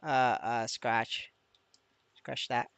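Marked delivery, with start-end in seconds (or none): none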